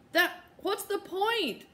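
high, slow and dejected; despairing. Acted. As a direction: give up on it. Jessie despairing, head in hands.

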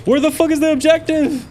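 real gamer rage; frustrated shout. Real, not acted. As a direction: snap up at the screen.

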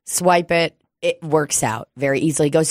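normal talking voice

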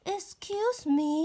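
high, slow polite princess. Acted. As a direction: ask sweetly and carefully.

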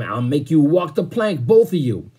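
angry threat. A narrator's reading. Captain threatening two stowaways with the plank, angry shout.

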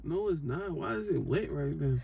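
soft, low, subdued question; calm, hushed puzzlement. Real, not acted. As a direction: say it quietly and low, without alarm. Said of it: "why you so calm".

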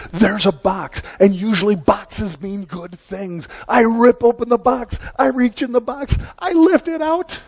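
high-pitched excitement, climbing steadily; acted excitement. Speaker re-enacting himself tearing open a Christmas present, mounting excitement. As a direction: build the pitch like a kid opening a present.

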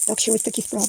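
usual speaking voice

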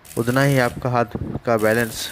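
ordinary talk, nothing marked

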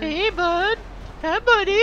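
high sing-song puppy talk; talking to a dog. Pretend. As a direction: coo at him like a pet dog. Teasing a friend like a puppy.

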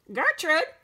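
high, loud squawked call; parrot scream. A narrator's reading. Parrot interrupts girl and store man, piercing scream. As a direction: screech it out like a parrot. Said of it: "the parrot screamed again".

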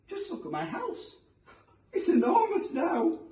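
put-on high, amazed old woman; delighted old lady. A narrator's reading. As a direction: marvel like a thrilled granny.

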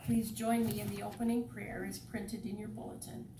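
ordinary talk

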